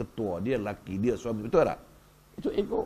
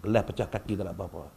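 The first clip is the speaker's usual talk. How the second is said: mak memuji anak dengan suara rendah; pujian lembut; 5 semitones lower, 5 dB quieter than usual. Pretend. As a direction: puji anak perlahan-lahan, tenang dan memujuk. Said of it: "kita puji dia". (lembut memujuk)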